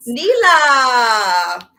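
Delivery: big announcer call of the guest's name; hype intro shout. Real, not acted. Host hyping up the incoming guest, loud excited call. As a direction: call her name out like a host bringing on a star.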